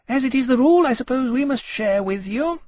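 high, haughty dragon voice; condescending. A narrator's reading. She-dragon grants a share to her rival, condescending. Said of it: "with an air of condescension".

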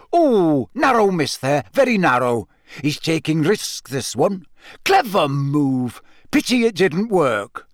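live commentator reacting to each move; excited commentary. A narrator's reading. Bagman commentates on Cedric facing the dragon, excited and swooping. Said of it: "Bagman's commentary made everything much, much worse".

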